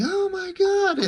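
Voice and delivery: high shrieking scream; a terrified guard screaming in shock; mock scream. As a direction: shriek it in panic. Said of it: "the guy starts screaming".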